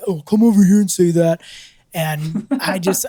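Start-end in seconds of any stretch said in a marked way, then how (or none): 0.1-1.4 loud mock-confrontational challenge; imitating a belligerent neighbor, raised voice; imitated bowing up. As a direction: puff up and dare him over. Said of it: "bowed up on me".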